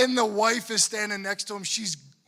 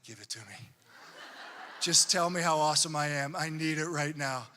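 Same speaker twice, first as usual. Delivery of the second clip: mock needy priest; 7 semitones lower than usual. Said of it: "And I'm like".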